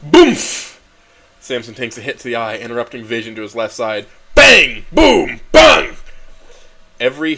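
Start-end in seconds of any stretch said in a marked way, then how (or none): 0.0-0.8 loud high punch sound effect; punch boom. Acted. Narrator voicing the punch, loud booming impact sound. 4.3-6.0 loud high shouted bangs; punch bangs. Acted. Narrator shouting punch sounds in the fight, loud bangs.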